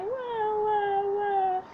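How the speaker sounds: put-on childlike wail; mock crying wah. A narrator's reading. Lost bunny wailing in the dark. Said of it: "cried baby Cassie Bunny".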